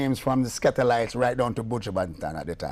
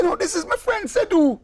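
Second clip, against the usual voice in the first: high, louder insistence; insisting excitedly; 5 dB louder than usual. Retold or imitated. [name] corrects the lady, high and insistent. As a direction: correct her, eager and sure. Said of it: "I say".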